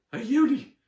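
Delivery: very high, loud squeaks that climb each time; stunned stammer. Acted. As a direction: stammer in mounting astonishment. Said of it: "stammered Emily".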